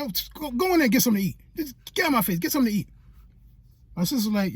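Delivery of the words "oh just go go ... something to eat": higher, fast dismissive imitation; quick, fussing motherly scolding; exasperated mom. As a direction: shoo him off like a fed-up mother. Exasperated mom dismissing son, acted out.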